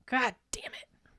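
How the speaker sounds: a hushed annoyed aside; whispered curse. Real, not acted. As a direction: whisper it under your breath.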